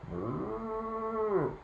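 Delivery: long, high rounded moo; cow mooing. A narrator's reading. Storyteller voicing the cow in the yard, long moo. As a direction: imitate a cow with one sustained moo. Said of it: "the, the cow going".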